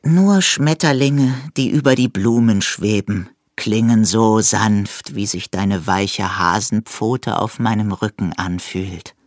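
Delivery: hohe, sanfte, langsame Eidechsenstimme; überglückliche Eidechse. A narrator's reading. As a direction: selig und gedehnt schwärmen. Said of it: "sagte die Eidechse und war überglücklich". (die Eidechse dankt dem Hasen, selig und langsam)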